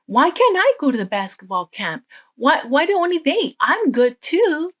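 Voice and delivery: put-on a boy whining high and pushed to his dad; whiny child. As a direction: plead like a kid left out of camp.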